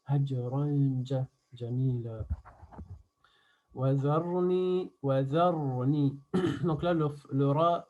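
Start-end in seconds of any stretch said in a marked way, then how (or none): 0.1-2.3 slow "Hajran" with the n held as a nasal hum into "ja"; ikhfa demonstration. Shown as a demonstration. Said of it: "on va préparer ikhfa". 3.7-6.2 slow "Wa dharni" with a full, thickened r; heavy ra. Shown as a demonstration. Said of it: "il est mufakham ou muraqqaq".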